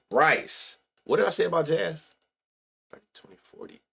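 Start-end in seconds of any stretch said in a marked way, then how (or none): 2.9-3.8 numbers whispered under his breath; reading stat numbers to himself, whispered. Real, not acted. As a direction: whisper the stats to yourself.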